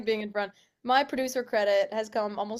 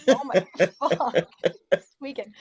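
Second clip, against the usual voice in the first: laughing; 3 semitones higher, 9 dB louder than usual. Real, not acted. Laughing at a glitch.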